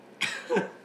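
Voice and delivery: high breathless laugh bursting out; burst of laughter. Real, not acted. Host cracking up at the fish joke, laughing.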